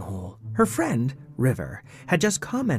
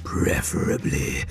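slow eerie whispered monster; creepy whisper; slower than usual. Acted. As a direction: drag out a sinister whisper. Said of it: "said Cthunkel".

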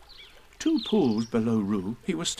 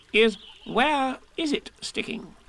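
high, slow, self-important; fussy Rabbit; 12 semitones higher, slower than usual. Acted. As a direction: ponder it pompously.

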